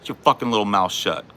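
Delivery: ordinary delivery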